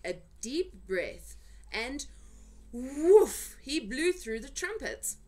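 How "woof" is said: loud high blowing woof; puffed woof. A narrator's reading. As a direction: blow the sound out hard. Sound of giant's trumpet blow.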